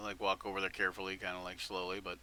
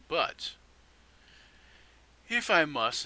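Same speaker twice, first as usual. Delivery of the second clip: reluctant; 7 semitones higher, 6 dB louder, slower than usual. Acted. Character line, resigned.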